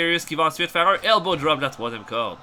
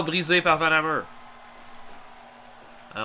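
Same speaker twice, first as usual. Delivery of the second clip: usual speaking voice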